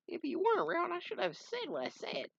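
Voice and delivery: very high, put-on voice; squeaky, sheepish reply; high character voice. Comic character voiced by reader.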